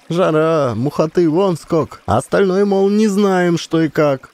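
put-on high, brisk instructions to the girls; commanding. A narrator's reading. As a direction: give orders quickly and firmly. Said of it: "а баба скомандовала девчонкам". (баба командует девчонкам, решительно)

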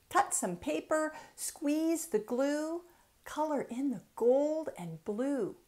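lilting, rhythmic picture-book reading; reading to children. Acted. Author reading her book aloud to children, lilting storytime. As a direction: read each rhyme like a bouncy storytime.

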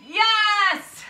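high, loud burst of praise; excited cheer. Real, not acted. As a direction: cheer the class on. Coach cheering class on.

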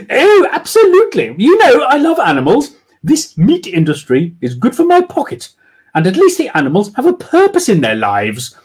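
high, loud posh lady, coldly gushing; cruel. Acted. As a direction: relish the cruelty. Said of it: "Felicity added cruelly".

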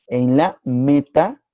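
META- drawn out slowly and left hanging on a rise; slow, unfinished "meta-", rising expectantly; leading prompt. Shown as a demonstration. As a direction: stretch "meta-" as a cue for the class to finish. Teacher cues student to finish word.

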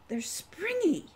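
put-on girl stomping on springy flowers, gleeful. A narrator's reading. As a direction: bounce with glee.

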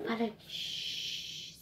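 soft hush, like sharing a secret; conspiratorial whisper to the class. Pretend. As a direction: whisper it to the kids, shh.

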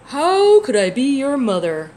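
loud, slow, different cow voice. Acted. Cow answering the little bird, loud and slow. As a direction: boom it out slowly like a big cow.

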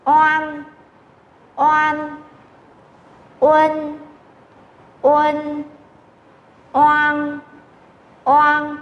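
"Oan", "Uân", "Oang" each held high and level; model finals. Shown as a demonstration. Teacher models finals for students, "Oan", "Uân", "Oang" sustained.

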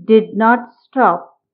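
plain reading voice

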